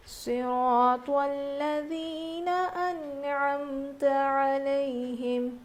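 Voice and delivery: slow, drawn-out melodic tilawat; Quran recitation. Acted. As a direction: chant each verse slowly with tajweed. Reciting Fatiha before the lesson. (آہستہ، لمبی کھینچی ہوئی تلاوت)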